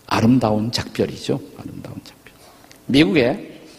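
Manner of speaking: ordinary talk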